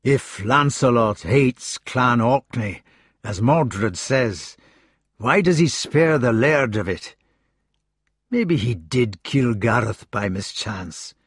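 slow Scottish burr, restless doubt; brooding Scots Gawain. Acted. Gawain brooding to Arthur in a slow Scottish burr. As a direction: turn it over slowly in a Scots voice.

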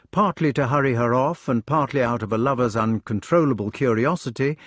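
plain reading voice

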